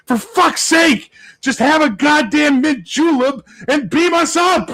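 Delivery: a player finally snaps at the table; exasperated shout. Retold or imitated. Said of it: "he just lost it".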